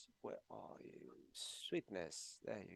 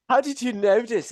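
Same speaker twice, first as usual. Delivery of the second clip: laughing, higher and a little louder; 8 semitones higher, 4 dB louder than usual. Real, not acted. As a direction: laugh through it, flattered and surprised. Laughing, caught out by a compliment.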